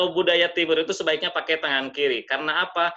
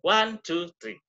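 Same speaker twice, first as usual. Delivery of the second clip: "One, two, three" with ONE pushed higher; demonstrated intonation; 4 semitones higher, slower than usual. Shown as a demonstration. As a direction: count out with a lilting emphasis. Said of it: "terus ada intonasi itu".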